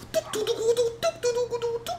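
very high tuc tuc beat sounds; mimicked drum. Teller imitating the busker's drum, high vocal beats. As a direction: tap out a clumsy drum rhythm with your voice.

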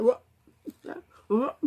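speaking into palm; muffled behind hand. Real, not acted. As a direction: hand covering mouth. Seen 2: Palm pressed over mouth throughout the labelled words; not in references.

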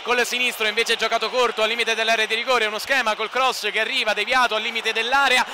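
normal talking voice

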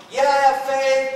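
loud, high protest; overinsistent believer. Acted. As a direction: insist loudly on your faith. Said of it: "it's happened so many times that it's like".

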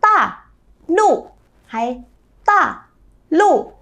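第四声 高起急降; sharp fourth tones. Shown as a demonstration. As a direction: start each syllable high then cut it off hard. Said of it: "nâng một tông giọng ... dứt khoát xuống".

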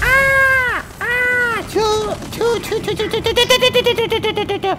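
very high squeals into rapid-fire choos; squeal, then machine-gun the noises at a high pitch; high squealed sound effect. Pretend. Player making own sound effects, high rapid squealed noises.

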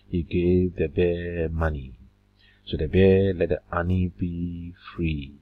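rhythmic poem reading, stressing the rhymes; limerick recitation. Acted. Teacher reading a limerick example aloud, rhythmic. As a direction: lean into the beat.

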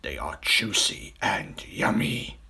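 very slow, savouring villain crocodile; relishing crocodile. Acted. Big crocodile announcing his wished-for lunch, slow and relishing. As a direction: linger hungrily on every word.

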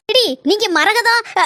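usual speaking voice

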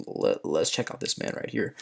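breathy whisper of the next check; whispered aside. Real, not acted. Streamer whispers as he checks a viewer's account, whispering. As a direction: drop to a whisper.

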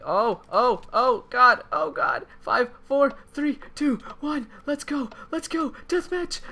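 very high excited countdown; ecstatic cheering. Real, not acted. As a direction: cheer as the timer hits zero.

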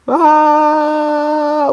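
drawn-out yell of alarm; long high scream. Real, not acted. Player hit by a game trap, high scream.

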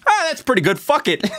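high breezy mock shrug; careless self-mockery. Mocking his own lazy editing, high careless shrug. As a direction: toss it off way up high, not caring. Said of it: "cut the shot to me going".

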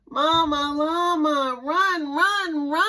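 high, loud urgent call; urgent cheering. Acted. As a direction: hurry her along, urgent. Urging mama to hurry.

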